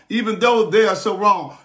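usual speaking voice

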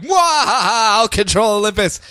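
mock evil laugh and boast; cartoon villain. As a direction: ham up a cartoon villain gloating.

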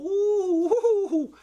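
high loud cry of delight; ecstatic ooh. Real, not acted. Preacher cries out in delight about heaven, high whoop. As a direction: whoop with joy. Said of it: "ooh cry".

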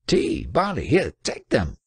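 high, swooping urging; boisterous trader. A narrator's reading. Trader thrusting bags at the hermit, boisterous and high. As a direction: press it on them in a high voice.

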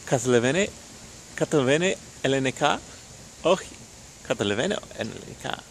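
softly recited Greek, word by word; careful Greek phrase. Shown as a demonstration. Shout out to college roommate, careful Greek phrase. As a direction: try out "Katalevene, Elenika" in learner's Greek. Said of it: "my one and only Greek phrase".

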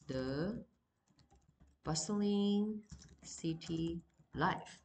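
word by word with long pauses, slow; slow, spaced classroom dictation. Shown as a demonstration. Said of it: "就是he".

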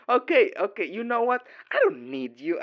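loud, high pretend huff; hammed-up outburst at an absent sweetheart; mock rejection. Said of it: "I'm joking".

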